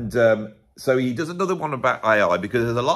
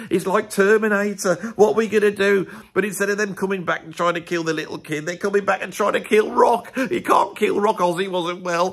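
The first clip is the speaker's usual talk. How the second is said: mock panic; 10 semitones higher than usual.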